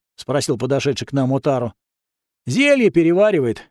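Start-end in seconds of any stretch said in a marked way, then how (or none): none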